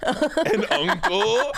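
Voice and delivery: UNCLE drawn out slow and high, with a diphthong; mimicked diphthong. Cohost mimics a guest's odd vowel, UNCLE stretched high with a diphthong. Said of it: "why was there a diphthong on that".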